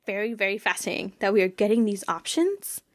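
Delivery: usual speaking voice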